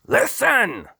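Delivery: very high, loud call. Acted. The Duke calls his spy out the windows, very high and loud.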